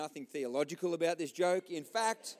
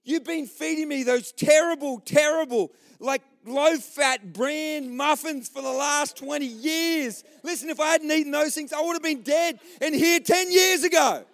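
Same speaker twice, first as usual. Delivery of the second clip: loud, high hammed-up rant; angry shouting; 7 semitones higher, 8 dB louder than usual. Man blaming his wife for healthy food, furious. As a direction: build to a yelled punchline. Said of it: "he's angry and he's shouting".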